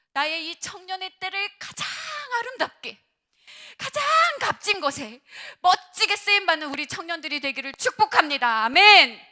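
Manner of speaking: high, loud sermon cry, building to amen; full-force preached blessing. Real, not acted. Pastor blessing the young people, impassioned loud preaching. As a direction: proclaim the blessing, stretching the key words.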